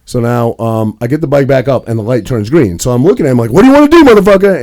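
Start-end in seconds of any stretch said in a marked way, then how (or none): 3.5-4.6 loud challenging yell at the other driver; shouting a dare out the window; retold shout. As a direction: bark it out high, picking a fight. Road rage challenge to driver.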